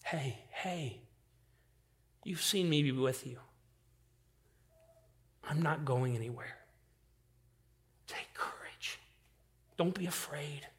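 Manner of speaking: gentle whisper. Acted. Jesus whispering comfort to a listener in the storm, softly. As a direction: hush them like a scared child. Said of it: "hear him saying that to you".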